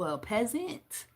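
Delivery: ordinary talk, nothing marked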